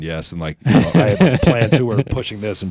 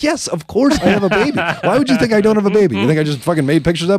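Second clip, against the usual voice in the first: much higher, loud fake offense; mock indignation; 11 semitones higher, 3 dB louder than usual. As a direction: play offended, totally deadpan lie. Mock indignant answer to partygoer.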